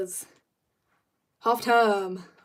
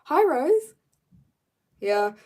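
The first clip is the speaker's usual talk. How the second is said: usual speaking voice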